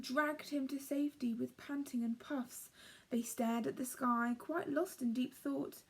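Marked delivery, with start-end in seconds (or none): none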